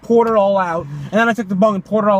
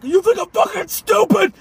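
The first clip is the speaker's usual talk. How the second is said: high-pitched outraged impression of a scolding; high, strained mock shouting; angry mock yell; 12 semitones higher than usual. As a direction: imitate a furious telling-off, pitched way up. Retelling an angry parent's scolding.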